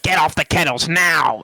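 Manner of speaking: slow mock bossy command; hammed-up boss ordering; imitating the boss. Boss ordering a worker off the kettles, slow mock command. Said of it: "he'd be like".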